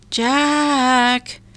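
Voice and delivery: put-on high, distant call of the kids' names; a mother calling her children home from far away; calling from afar. A narrator's reading.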